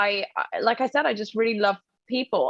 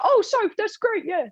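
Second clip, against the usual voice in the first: much higher, gushing impression of a friend; imitating James's voice; 9 semitones higher than usual. Guest imitating James texting about the show, high and gushing. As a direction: squeal it out like an excited friend. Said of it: "But me talks like this".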